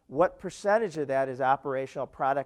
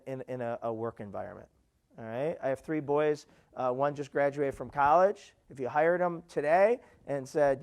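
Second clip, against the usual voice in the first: normal talking voice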